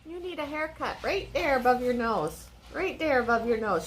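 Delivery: soft doting voice to a dog; pet talk. Real, not acted. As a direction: tease the pup gently about its fur. Talking to the dog.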